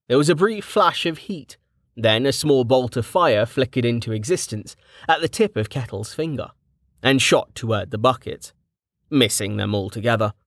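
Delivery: ordinary delivery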